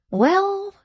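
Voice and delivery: sharp, high snappish "Well". A narrator's reading. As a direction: snap it out impatiently. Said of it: "retorted Venus snappishly".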